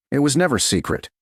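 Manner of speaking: ordinary delivery, nothing marked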